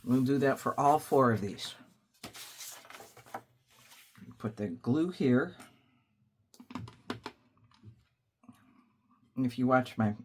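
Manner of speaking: chewing on a hard sucker; crunching while talking; crunching candy. Real, not acted. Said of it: "sorry if I'm crunching and you can hear it".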